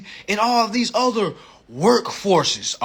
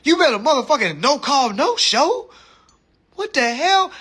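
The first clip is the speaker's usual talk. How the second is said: higher, louder, rising outrage; mock outraged; 6 semitones higher, 4 dB louder than usual. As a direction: scold her not to cancel anything. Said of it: "And I'm like".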